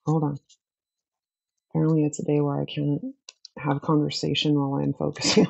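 normal talking voice

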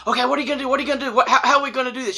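high, fast, panicky questions; retold nervous fretting, words tumbling out; freaking out. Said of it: "I am absolutely freaking out".

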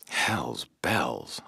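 low, muttered under the breath; quiet muttered oath; muttered curse. A narrator's reading. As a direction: grumble it to yourself. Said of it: "I muttered".

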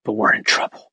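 breaking into a whispered cry; tearful whisper. Retold or imitated. Husband confessing money trouble, tearful and whispered. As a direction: let the words crack out under tears. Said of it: "starts crying and goes".

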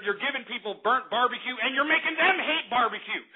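usual speaking voice

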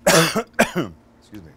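strained, slow, loud after coughing; forcing words out after a cough; hoarse after coughing. Real, not acted. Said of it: "I swallowed a bug".

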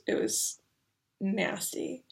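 usual speaking voice

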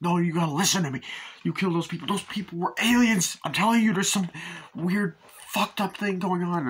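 high, insistent acted plea, slowing at the end; urgent pleading. Hero tells friend about aliens, urgent high-pitched imitation. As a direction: imitate a man desperate to be believed.

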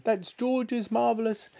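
high, drawn-out urgent warning; crying out. A narrator's reading. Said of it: "cried Missus Cranky".

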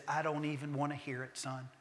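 soft, whispered welcome home; tender father. Retold or imitated. Father to returning son, soft tender whisper. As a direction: hush the son's apology gently.

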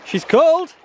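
high yelp about the cold water; squeaky cold reaction. Real, not acted. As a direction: squeak it out, freezing. Stepping into cold river.

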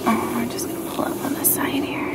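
soft whisper by the door. Real, not acted. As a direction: whisper so no one hears. Sneaking up quietly outside house.

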